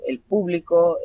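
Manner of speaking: "público" with the beat on PÚ; stress on first syllable. Shown as a demonstration. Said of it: "según mi pronunciación".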